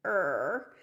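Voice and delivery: stretched creaking "Er"; creaking cry. Shown as a demonstration. Teacher showing the tree branch sound, creaking "Er". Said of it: "it's gonna sound like this".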